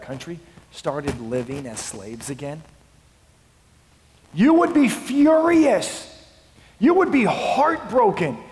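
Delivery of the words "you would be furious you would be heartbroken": usual speaking voice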